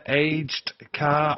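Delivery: slow, deliberate aged car, both words split apart; spelling out a username. Shown as a demonstration. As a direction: read out aged car slowly, word by word. Reading out an awkward username.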